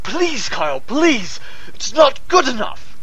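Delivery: frantic, escalating plea; desperate pleading. Acted. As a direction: beg as if the show depends on it. Begging to rehearse once more.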